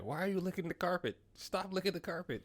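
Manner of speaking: scolding dog owner. Retold or imitated. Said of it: "it was just like".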